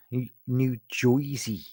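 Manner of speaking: slow, drawn-out put-on New Jersey accent; mock New Jersey accent. Shown as a demonstration. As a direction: stretch out New Jersey like a Jersey local. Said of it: "My, my accent is so on point".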